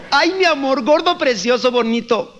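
butter him up like a scheming wife; sweet-talking wife. Retold or imitated. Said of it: "llegan las damitas".

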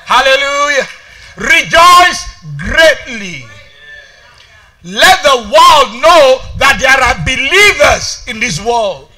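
loud, emphatic sermon delivery; forceful preaching. Real, not acted. Preacher urging the church to refuse sadness, forceful preaching.

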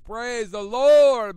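a drawn-out, overjoyed cry of thanks; joyful shout. Retold or imitated. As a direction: belt it out in sudden joy. Retold joy at winning bike.

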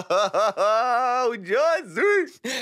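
long drawn-out amazed yoo, then the name; stunned yoo. Retold or imitated. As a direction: stretch it out in disbelief. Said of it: "איך שראיתי את זה אמרתי". (תדהמה מתמשכת ואיטית)

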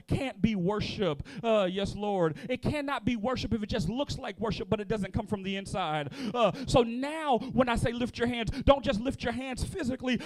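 normal talking voice

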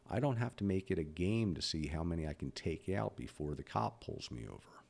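ordinary delivery

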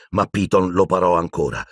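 plain reading voice

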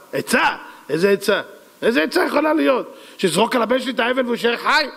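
high-pitched disbelieving questions; incredulous. Acted. King doubting his advisor's advice, incredulous.

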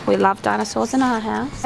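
ordinary delivery

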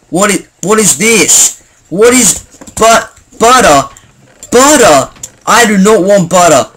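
mock-horrified outburst; mock outrage. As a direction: protest as if butter were an insult. Mock horror at the word butter.

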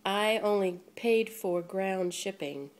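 usual speaking voice

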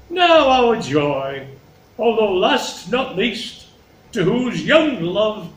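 lightly voiced king, slow and grand; fond stately king. King turns to Cordelia his youngest, stately and slow. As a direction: draw out each phrase with ceremony. Acts Lear addressing Cordelia.

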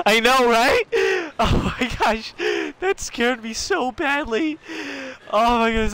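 high, squeaky, tearful giggle after a fright; laugh-cry it out, still shaken; scared to tears. Real, not acted. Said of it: "I'm crying because of how bad I got scared".

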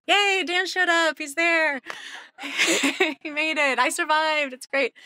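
high-pitched celebratory cheer; playful hurrah with a laugh; mock elation. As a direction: exaggerate delight at his arrival, pitched high. Acts out groggy joy at husband's arrival.

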